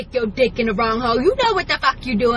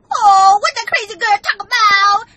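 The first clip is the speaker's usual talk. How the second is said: higher, louder nosy gossip voice; mocking lurker; 7 semitones higher, 5 dB louder than usual. Mimicking nosy lurkers, higher gossip voice. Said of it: "lurkers talking about".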